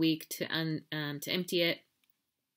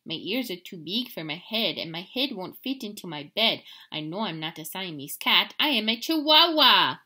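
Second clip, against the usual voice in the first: put-on a light Spanish accent; 7 dB louder than usual. Skippy playing Chihuahua, light Spanish accent. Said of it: "using his very best Spanish accent".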